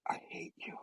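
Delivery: acted hushed hatred; very soft, voiceless whisper; bitter whisper. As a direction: whisper it with venom. Norman's bitter line, acted.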